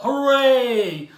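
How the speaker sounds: high, loud drawn-out hooray; long falling cheer, hammed-up; mock cheer. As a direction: give a sarcastic, over-the-top cheer. Mock cheer at another jungle.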